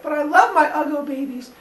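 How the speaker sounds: high whiny mother; mock whine about her babies; mild mock whine. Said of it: "incredibly upset".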